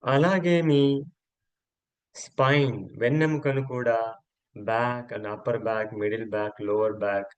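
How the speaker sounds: slow, measured relaxation voice with long pauses; guided yoga nidra. Acted. Yoga teacher guides friends to relax each body part, slow guided meditation. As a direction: let each instruction stretch out and settle.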